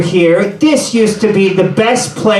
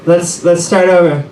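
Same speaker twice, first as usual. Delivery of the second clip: ordinary delivery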